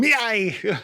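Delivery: mock pirate yar yar; hammed-up pirate. Joke-teller savouring the pirate image, hammed-up pirate. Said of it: "the visual of that".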